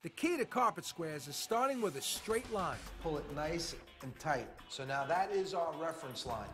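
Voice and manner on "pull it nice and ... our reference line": normal talking voice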